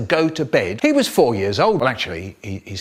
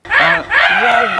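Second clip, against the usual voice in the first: laughing shout; 6 dB louder than usual. Real, not acted.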